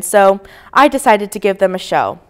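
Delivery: ordinary delivery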